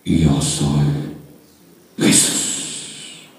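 very low divine voice, trailing into breath; Jesús. Acted. The Lord revealing himself to Saulo, deep solemn voice. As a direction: intone it low and weighty. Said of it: "Y le dijo".

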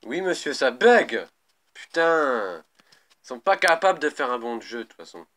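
loud frustrated outburst; raging gamer. Real, not acted. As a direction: rage at the buggy game. Game bugs, player rages.

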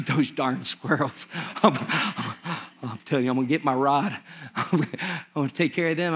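low, soft grumbling Peter; grumbling homeowner. Acted. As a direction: mutter about the squirrels, voice dropped low. Peter grumbling about roof noise.

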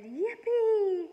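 high shrieking yippee; gleeful yell. A narrator's reading. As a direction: whoop with joy. Cassie cheering.

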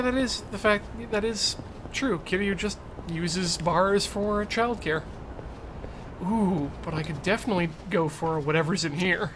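ordinary talk, nothing marked

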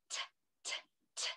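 "T, t, t" as short unvoiced t taps; t sound. Shown as a demonstration. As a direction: tap out the t sound. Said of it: "what does T say".

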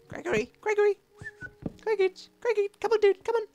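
very high, squeaky sing-song call to the golem. Real, not acted. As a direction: coax the golem along in a tiny high voice. Calling the golem to follow.

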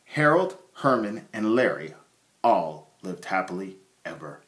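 plain reading voice

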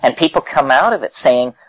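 normal talking voice

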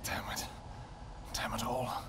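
usual speaking voice

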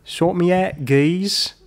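high-pitched mock Cockney; Cockney accent. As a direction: put on a cheeky Cockney accent. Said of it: "That was your Cockney accent".

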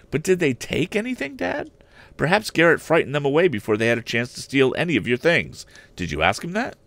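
a keen lad pressing his father; eager young Tom. Acted. Tom questions his father about the theft, high and eager.

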